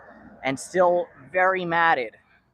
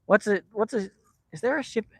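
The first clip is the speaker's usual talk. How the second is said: ordinary delivery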